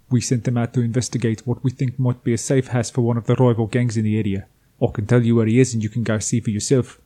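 put-on not-very-good South African accent. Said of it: "I decided to give him a South African accent".

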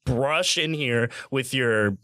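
acted exasperated rant; indignant protest. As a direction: argue your claim like someone cheated out of a turn. Acted quarrel over who came first.

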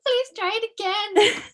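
high, put-on pleading voice; sweet pleading. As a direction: beg the scanner innocently. Pleading with ticket scanner.

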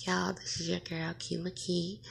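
ordinary talk

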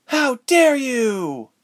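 high, loud, drawn-out scream; furious scream. Acted. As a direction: scream it slowly in outrage. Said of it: "Ilse screamed loudly".

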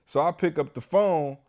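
normal talking voice

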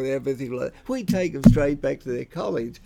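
usual speaking voice

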